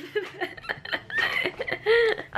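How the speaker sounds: a high bubbling laugh; high giggle. Real, not acted. Vlogger laughing at the nudity comment, high giggle. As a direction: giggle at her own joke.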